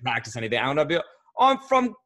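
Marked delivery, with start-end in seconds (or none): 1.4-1.9 high, slow, hammed-up British accent; bad British accent from an Xbox kid; terrible British accent. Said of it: "it would be a terrible accent".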